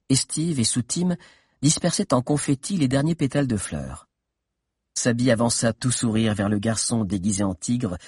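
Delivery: normal talking voice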